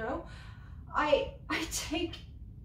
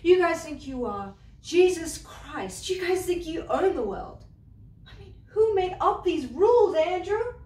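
building louder and higher, furious; rising fury; 4 semitones higher, 4 dB louder than usual. Acted. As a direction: hammer the accusation home. Climactic rant at Andrew.

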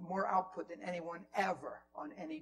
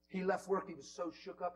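normal talking voice

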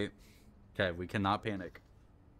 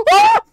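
a sudden, very high, loud gasping shriek; 22 dB louder than usual. Real, not acted. Gamer falling off the ledge, a sharp high shriek. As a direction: scream as you fall. Falls in the game.

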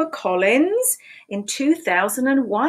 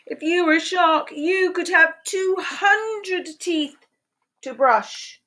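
lively picture-book read-aloud, questions pitched high; animated storytime voice with playful questions; reading to children; 6 semitones higher, slower than usual. Acted. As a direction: read it like storytime for little ones.

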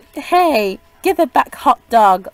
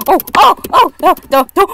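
panicked cries; 7 semitones higher, 8 dB louder, much faster than usual. Real, not acted. Panicking as Kirby attacks.

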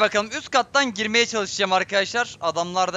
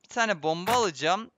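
usual speaking voice

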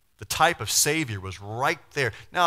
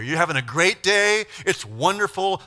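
gushing delight; 8 semitones higher, 9 dB louder than usual. Acted. Mock-happy scenario.

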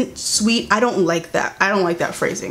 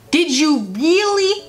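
incredulous; 6 semitones higher, slower than usual. Real, not acted. Incredulous challenge to Shane.

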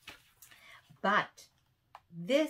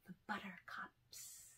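whispered ending; 18 dB quieter than usual. Acted. Ending Buttercup Days for the children, whispered. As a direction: fade to a whisper. Poem fades to close.

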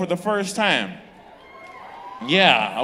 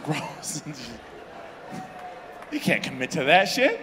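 usual speaking voice

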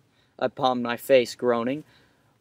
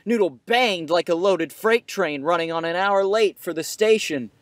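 usual speaking voice

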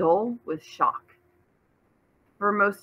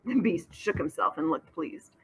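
plain reading voice

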